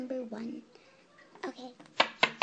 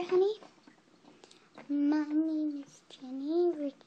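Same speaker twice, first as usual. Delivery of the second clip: usual speaking voice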